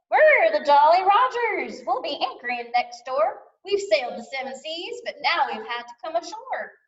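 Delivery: put-on high-pitched, eager young pirate; pirate boy. Pirate boy introducing his family to the new neighbor, eager. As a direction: introduce the crew proudly in a high boyish voice.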